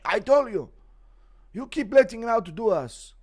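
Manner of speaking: put-on high accented stern scold; a hint of a stern Dagestani; Islam impression. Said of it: "Impression on Islam beating his goat wife".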